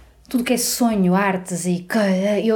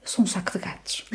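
breathy whispered aside; whispering; 8 dB quieter than usual. Real, not acted. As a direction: whisper it to yourself. Repeats her phrase for effect.

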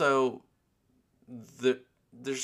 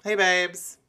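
high, loud bright hey babes; perky greeting; 9 semitones higher, 10 dB louder than usual. Acted. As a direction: chirp it out to the listeners. Repeated greeting tryout.

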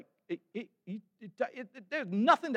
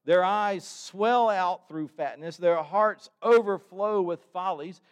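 impassioned scripture reading; 3 semitones higher, 6 dB louder than usual. Acted. Pastor proclaiming the psalm to the congregation, impassioned reading. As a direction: preach the verses with fire.

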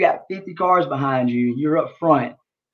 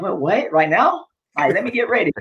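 higher, bewildered questions; mock flustered protest on being woken; startled retelling; 6 semitones higher than usual. Retelling being caught by camera.

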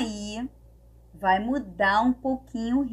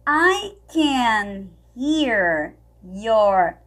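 ordinary talk, nothing marked

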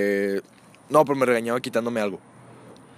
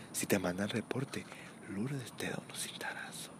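whispered, slow and very soft; hushed warning; 4 semitones lower, 17 dB quieter, slower than usual. Retold or imitated. Kid whispering the playground warning about Lourdes. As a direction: breathe it like a secret rumor.

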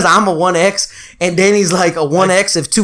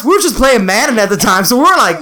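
ordinary delivery

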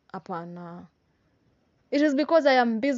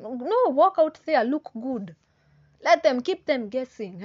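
emphatic call; 5 semitones higher, 6 dB louder than usual. Real, not acted. Urging listeners to walk tall.

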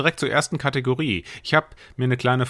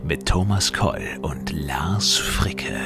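breathy trailer whisper; 6 semitones lower, much slower than usual. Acted. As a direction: whisper the names. Whispered intro names.

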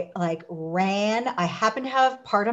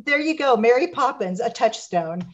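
ordinary talk, nothing marked

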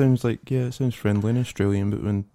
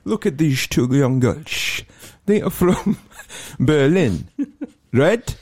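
slow, higher Dutch-English narration; mock Dutch-accented English, slow and leering; Dutch porn narrator; 6 semitones higher, much slower than usual. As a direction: draw it out like a cheesy Dutch commentator. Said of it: "a Dutch guy with really bad English".

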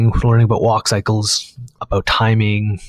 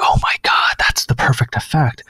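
awed whisper; 3 semitones higher than usual. Retold or imitated. Awed by Particular demos.

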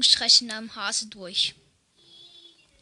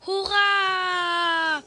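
hoher, lauter Freudenschrei; Jubelschrei; 7 semitones higher, 4 dB louder than usual. Acted. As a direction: jubel laut wie ein Kind. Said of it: "Da schreit der Junge". (der Junge schreit die Lehrerin an, hoher lauter Jubel)

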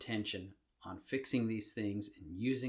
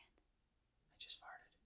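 slow, hushed whisper; 22 dB quieter, much slower than usual. Real, not acted. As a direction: whisper it as a sly confession. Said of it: "Why are we whispering?".

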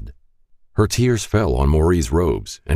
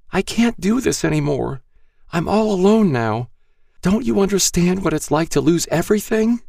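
put-on high, grieving young woman; grieving girl to Rick, high and despairing; Star's despair. A narrator's reading. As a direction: cry out in hopeless grief. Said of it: "she said".

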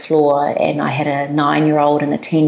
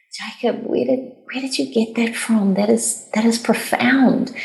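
amazed; 7 semitones higher than usual. Retold or imitated. Mother to young son Jacob, stunned by his wisdom, amazed. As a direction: marvel at what the boy just said.

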